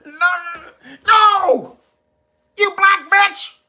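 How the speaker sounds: high, loud hammed-up crying yell; mock wailing breakdown. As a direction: wail it like they're losing it. Critics breaking down.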